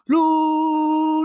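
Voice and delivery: loud, high stadium yell; shouted heckle. Retold or imitated. Left fielder yelling back at fans, loud high shout. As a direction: call it out across the outfield. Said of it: "So he would yell".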